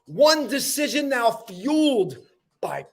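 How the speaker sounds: slow, emphatic spoken-word delivery, high attacks falling away; dramatic poem recital. Acted. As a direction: declaim each line, pausing before the last words. Recites own motivational poem.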